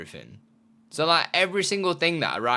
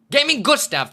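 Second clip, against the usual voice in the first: high, loud shout-out; excited callout; 13 semitones higher, 8 dB louder than usual. Real, not acted. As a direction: greet him loudly. Calls out new subscriber.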